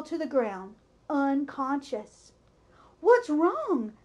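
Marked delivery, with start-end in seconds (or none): 3.0-3.9 louder, higher call of alarm; announcer startled into a shout. A narrator's reading. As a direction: loudspeaker calling out in alarm. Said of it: "asked the loudspeaker".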